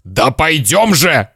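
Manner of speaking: slow, hoarse urging; rasping father. Acted. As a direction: croak it out impatiently. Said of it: "захрипел папа".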